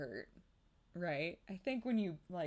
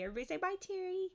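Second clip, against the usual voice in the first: sing-song bye; much faster than usual. Real, not acted. Prompting the baby to wave.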